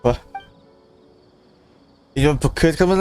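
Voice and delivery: ordinary talk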